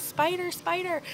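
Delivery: high-pitched panicked cry, held back in volume; mock scream. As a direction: re-enact screaming about a spider without full force. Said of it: "screaming at the top of my lungs".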